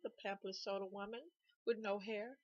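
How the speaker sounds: ordinary delivery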